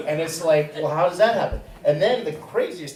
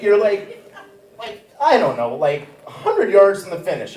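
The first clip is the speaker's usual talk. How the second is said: ordinary talk, nothing marked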